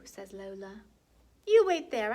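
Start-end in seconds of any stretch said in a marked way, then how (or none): 1.4-2.1 put-on higher, much louder little-girl order; excited command. Lola telling her brother to wait, loud and excited. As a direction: bark it out eagerly.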